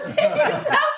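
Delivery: high, laughing through the words; laughing warning. Retold or imitated. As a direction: crack up while you urge escape.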